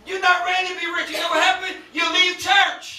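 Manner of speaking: high, loud preaching with stretched final words. Real, not acted. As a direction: drive it home to the congregation.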